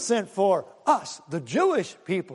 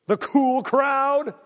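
mock cool crowd; 6 semitones higher, 4 dB louder, much slower than usual. Mocking the popular kids.

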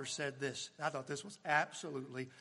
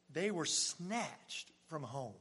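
slow, weighted telling; solemn slow emphasis; 2 semitones lower, 4 dB quieter, much slower than usual. Acted. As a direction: lean on every word.

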